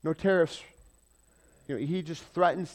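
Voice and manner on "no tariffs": loud, higher, blustering tariffs; hammed-up politician shouting tariffs; mock Trump. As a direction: bark the word like a president on TV. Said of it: "and he says".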